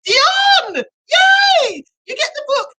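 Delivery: high, loud cheer of the winner's name; excited announcement. Real, not acted. Host announcing the book prize winner to viewers, excited cheer. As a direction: call out the winner like a game show host.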